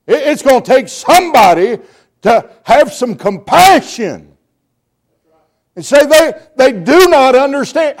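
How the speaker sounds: loud, high, shouted revival preaching; rising to a full shout, pleading with the congregation; preaching at full force. Acted. Preacher shouting to the congregation for compassion, full-force preaching.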